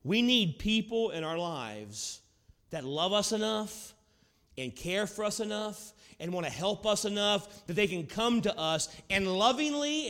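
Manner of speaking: ordinary delivery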